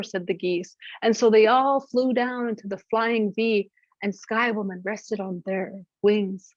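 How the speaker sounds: ordinary delivery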